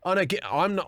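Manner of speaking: ordinary talk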